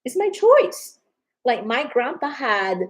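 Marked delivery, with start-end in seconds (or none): 0.1-1.0 defiant refusal. Retold or imitated. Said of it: "you have the right to say".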